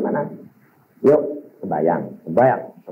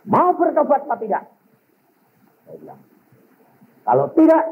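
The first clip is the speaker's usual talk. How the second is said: retold scolding; 8 semitones higher than usual. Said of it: "Saya marahin luar biasa".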